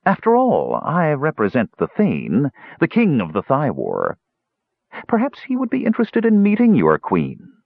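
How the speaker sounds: sly hunchback courtier, lightly voiced; a lightly voiced scheming hunchback; scheming Pitrick. Pitrick addresses the gully dwarf.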